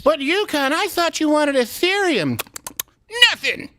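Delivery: high, slow cartoon voice; high goofy voice. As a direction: play the straight man in a cartoon. Sketch reply to the prospector.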